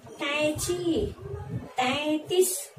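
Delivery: कैंची and तैंतीस very slowly with the nasal ऐं; slow nasal demo. Shown as a demonstration. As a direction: model कैंची and तैंतीस slowly for children to repeat. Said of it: "इसका उच्चारण क्या करेंगे?".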